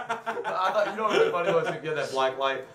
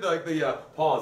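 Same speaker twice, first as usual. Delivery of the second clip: normal talking voice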